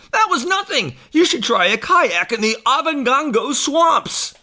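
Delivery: high, loud, blustering boast; Bill. Acted. Bill bragging to Harry about the swamps, loud blustering. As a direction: push it high and over the top. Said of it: "And Bill says".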